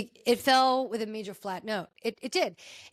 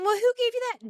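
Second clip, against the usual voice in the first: high challenging question; 10 semitones higher, faster than usual. Retold or imitated. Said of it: "cast questioning Marcus".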